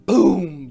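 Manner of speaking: loud sound effect boom. Acted. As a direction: slam it out like a door.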